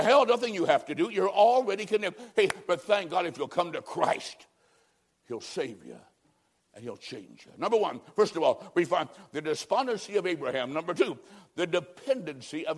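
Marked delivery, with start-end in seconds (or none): 5.3-7.5 tender appeal. Acted. Preacher gently inviting listeners to Christ, hushed and tender. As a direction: lower and much softer, pleading gently.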